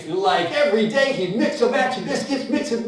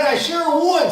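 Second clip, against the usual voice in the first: higher and louder, thrilled; overjoyed yes; excited Billy; 6 semitones higher, 4 dB louder than usual. Acted. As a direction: blurt it out with joy. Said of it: "he was so excited".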